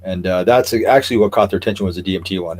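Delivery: usual speaking voice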